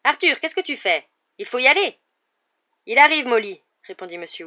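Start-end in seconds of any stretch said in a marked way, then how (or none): 0.0-2.0 high, loud call to a husband; impatient wife calling across a platform; urgent calling out. A narrator's reading. Wife calls husband to hurry. 2.9-3.6 loud, raised shout back to his wife; high, loud reply at a distance; calling back. A narrator's reading. Husband calls back to wife.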